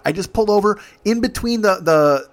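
ordinary talk

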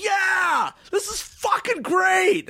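very high, slow, elated yell; high friend shouting euphorically; ecstatic shout. Retold or imitated. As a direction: yell it over the dance floor. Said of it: "He's like".

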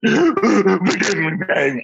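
high, drawn-out mock plea through laughter; mock begging. Imitating a man on video asking forgiveness, mock begging. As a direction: ham up the begging, high and slow. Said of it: "begging for forgiveness".

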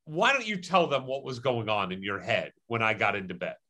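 ordinary talk, nothing marked

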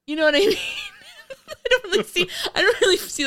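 high voice bubbling with laughter; laughing too hard to finish; cracking up. Real, not acted. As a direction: talk through real giggles. Cracking up at film absurdity.

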